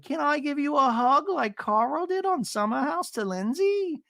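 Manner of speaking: high, slow mimicked voice; imitated sweet offer. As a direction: draw the question out high, lightly mocking. Imitates Gina offering a hug.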